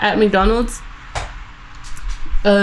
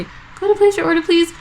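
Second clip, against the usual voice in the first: drive-thru voice; 8 semitones higher than usual. Retold or imitated. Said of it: "So I was like".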